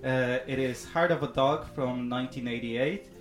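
ordinary talk, nothing marked

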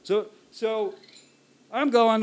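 ordinary delivery

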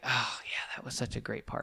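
hushed awed whisper. Retold or imitated. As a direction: breathe it like a fond memory. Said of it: "you're gonna be like".

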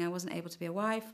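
ordinary talk

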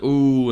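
teasing crowd ooh. Retold or imitated. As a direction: do the whole room's ooh. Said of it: "everyone goes like".

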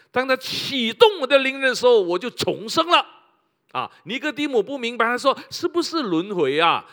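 loud, high preaching with rising fervor; fervent pulpit preaching; full-force preaching. Acted. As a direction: preach it out to the whole hall.

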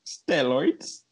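ordinary delivery

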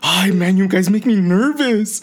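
high, slow, drawn-out whine of an usher; mock a fretting usher, stretching it out; imitated nervous usher.

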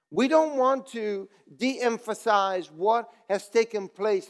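full revival preacher; preaching. Acted. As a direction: preach with rising fervour. Preaching building to full force.

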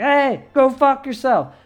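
loud, high mock heckler call; shouted heckle. Imagined basketball fan yells at players, shouted heckle.

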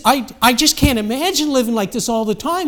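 worked-up, stammered start; excited young man. Acted. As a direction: gush it as the overwhelmed young man. Acted young man's amazed report.

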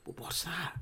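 frightened whisper. Acted. Frightened at an apparition.

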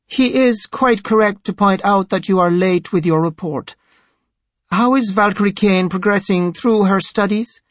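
high-pitched priest, in command; imperious high priest. A narrator's reading. High Priest ruling on the clerics' quarrel, imperious. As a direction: speak as the superior passing judgement.

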